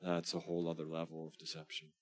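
ordinary delivery

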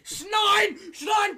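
loud, high barked fake German; mock German shout. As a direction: yell it like a cartoon German officer. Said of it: "Oh, Germany".

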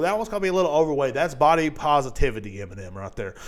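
ordinary talk

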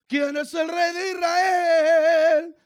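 loud, high, slow shout to the crowd; shouted call. Real, not acted. Pastor shouting the question for the church to answer.

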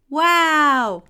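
high, loud, stretched wow; big storybook amazement; amazed gasp. A narrator's reading. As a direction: marvel at it like awestruck children. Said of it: "gasped the children".

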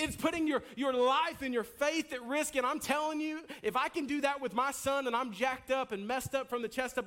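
usual speaking voice

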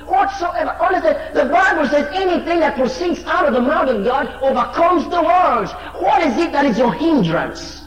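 high, loud preaching; urgent revival preaching; full-force preaching. Acted. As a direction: drive the point home loudly. Preacher climbs again.

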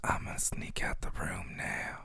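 hushed unvoiced whisper; whispering over a crib. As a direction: whisper so the baby stays asleep. Pretend sneaking out of nursery.

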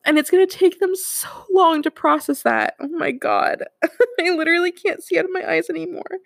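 real tears, fighting to get the words out; tearful. Real, not acted. Host breaking down to her listeners, tearful. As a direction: voice rising high and breaking with tears. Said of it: "I've just been a blubbering mess".

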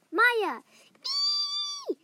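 high scared squeal; frightened shriek. Acted. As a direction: shriek in terror. Sees ghosts.

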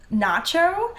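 NACHO drawn out in an American accent. Shown as a demonstration. Actor demonstrates her trigger word for an American accent. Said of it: "like, an American accent".

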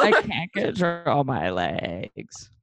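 very slow whiny drawl. Retold or imitated. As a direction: stretch it out, mocking the whine. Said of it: "very whiny voice".

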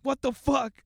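high, loud outburst of shock; retold shocked yell. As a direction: yell it in disbelief, acting out the moment. Retold shock at injured sister.

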